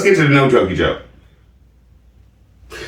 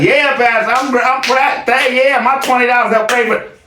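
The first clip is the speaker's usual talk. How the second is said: higher, hammed-up proud churchgoer; mock proud churchgoer; 11 semitones higher than usual. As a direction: fake pride over twenty dollars. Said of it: "would you sit there like".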